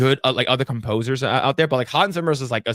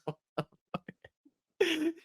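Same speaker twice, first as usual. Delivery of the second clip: slow, high, much softer mock gasp; stunned disbelief; 14 semitones higher, 11 dB quieter, much slower than usual. Reacting to a guy crashing out, hushed shock. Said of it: "It's just like".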